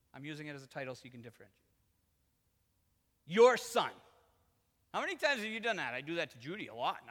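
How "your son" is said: YOUR son, with YOUR hit high and loud; loud, pointed stress on "Your"; accusing son. Acted. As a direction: punch "Your" to disown the brother. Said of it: "what does the son say?".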